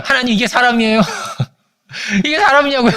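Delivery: high-pitched mock complaint breaking into laughter; exasperated prayer, laughing. Evangelist complaining to God about someone, retold exasperated and laughing. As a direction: imitate an indignant plea while cracking up.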